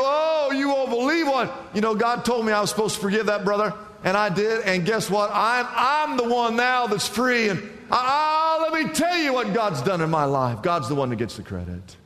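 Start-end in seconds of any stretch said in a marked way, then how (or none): 0.0-10.6 high, gushing acted testimony; excited testimony. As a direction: gush it high, bursting to share the news. Acts excited testimony of forgiven believer.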